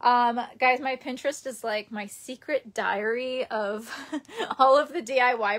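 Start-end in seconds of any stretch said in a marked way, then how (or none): none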